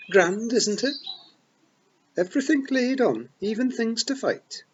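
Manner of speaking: high, loud Scots pixie, full of pride; excited Feagle boast. A narrator's reading. Rob boasts to Tiffany about being born here, loud and proud. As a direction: wee warrior proudly telling his tale.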